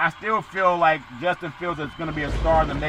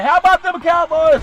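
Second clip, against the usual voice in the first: excited shout; 12 semitones higher, 9 dB louder than usual. Acted. Hypes the Cowboys catchphrase.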